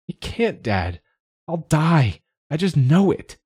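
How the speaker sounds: high, distressed outburst; panicked pleading. A narrator's reading. Tearful child sure of dying tells dad, panicked pleading. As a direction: plead as if close to tears.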